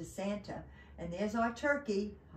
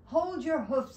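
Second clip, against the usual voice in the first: loud, higher storybook halt; elf's cry; 6 semitones higher, 6 dB louder than usual. Acted. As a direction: call out to stop an intruder. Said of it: "cried the elf".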